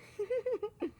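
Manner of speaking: high, light monster giggle. A narrator's reading. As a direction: titter in a tiny high voice. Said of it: "The monster giggled".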